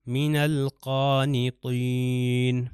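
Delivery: من القانطین slowly chanted, lam sounded; Quran recitation. Shown as a demonstration. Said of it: "یہ نون جو ہے الف لام سے مل جائے گا".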